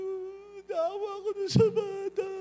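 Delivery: high, choked, crying voice; tearful, breaking voice; retold tearful reply. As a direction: relive the tears of pity for the child. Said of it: "iyak ka nang iyak".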